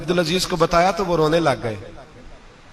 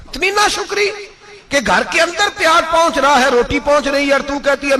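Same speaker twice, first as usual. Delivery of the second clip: indignant; 8 semitones higher than usual. Acted. Preacher rebuking ingratitude.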